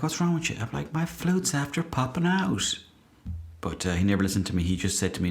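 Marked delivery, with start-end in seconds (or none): 0.0-0.6 higher, puzzled manager voice; lightly voiced manager asking what's wrong; a hint of the manager. Manager's voice, retold. 0.9-2.8 high, slow mock panic; hammed-up embarrassed player, higher and slow. Retold panicked plea.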